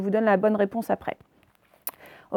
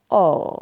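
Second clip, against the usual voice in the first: lower, open, falling "oh"; "oh" at burst bubbles; 4 semitones lower, 4 dB louder than usual. Acted. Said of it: "lorsque les bulles éclatent". (« oh » ouvert et déçu)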